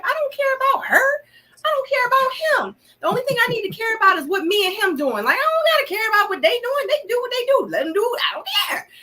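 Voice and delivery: high-pitched, fast, exasperated self-quote; emphatic indifference. Retold or imitated. Woman re-enacting her answers to questions about other women, high exasperated dismissal. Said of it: "And then I'd be like".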